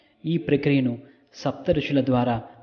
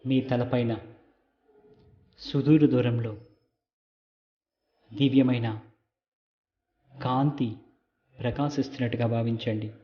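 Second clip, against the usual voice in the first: calm meditation guide with long pauses; guided meditation. Real, not acted. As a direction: lead them gently, phrase by phrase, leaving silences. Leads a light meditation. (నెమ్మదిగా, విరామాలతో ధ్యాన మార్గదర్శక స్వరం)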